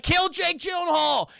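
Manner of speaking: slow, high shouted order; drawn-out comic war cry; mock battle cry. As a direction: call for his death like a mob. Crowd's command acted out.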